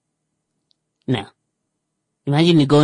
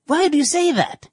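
mock baffled question; 13 semitones higher than usual. Couple baffled at the remark.

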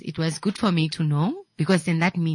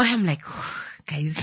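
usual speaking voice

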